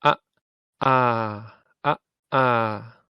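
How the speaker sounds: ah cut off as short as possible; short ah vowel. Shown as a demonstration. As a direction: clip the ah hard. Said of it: "Try and make it very short".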